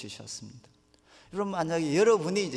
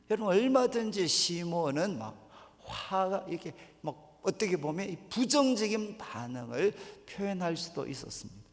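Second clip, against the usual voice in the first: ordinary talk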